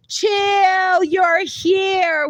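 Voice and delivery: loud, high, delighted shout of welcome; excited greeting. Real, not acted. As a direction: cry out as a friend turns up. Greets a friend just arriving in chat.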